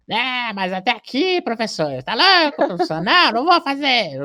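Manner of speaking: high, drawn-out student whine of refusal; mock indignant student, high exaggerated pleading; protesting pupil. Acting reluctant pupils' protest.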